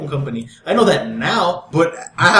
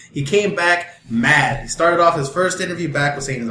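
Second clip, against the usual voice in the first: ordinary talk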